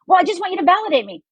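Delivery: high, quick mock whiny friend; complaining friend. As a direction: imitate the friend who just wants validation.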